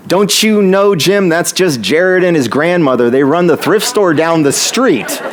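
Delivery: high-pitched impression of a scolding bank teller; exasperated telling-off. Teller telling the guard off for bothering regulars, exasperated. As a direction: act out a teller defending two regulars.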